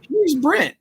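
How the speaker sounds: high, mocking Brent voice; Brent impression. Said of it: "That's the closest I could get to him".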